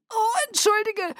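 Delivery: very high, strangled voice; choked cry. Acted. Sick woman breaking free of his embrace, choked cry. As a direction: squeeze it out high, about to be sick. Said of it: "rief ich erstickt".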